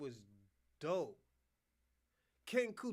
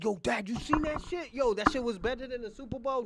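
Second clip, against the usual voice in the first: put-on high, loud kid hyped at dad; imagined excited kid; 8 semitones higher, 9 dB louder than usual. Kid raves to dad about the show, imagined excitement. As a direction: burst out like a thrilled kid. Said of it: "when your kids be like".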